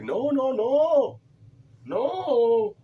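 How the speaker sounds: high, loud bad Indian accent protest. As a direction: squeal no over and over in a mock Indian accent. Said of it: "how's my, uh, Indian bad accent?".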